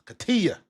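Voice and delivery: Katia said high as kuh-TEE-uh; corrected pronunciation. Shown as a demonstration. Thanking viewer for phonetics, Katia with a high TEE. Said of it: "for the phonetics".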